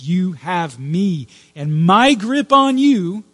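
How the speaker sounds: preaching emphasis. Acted. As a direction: lean hard into it, like a promise from the pulpit. Preacher voicing God's promise.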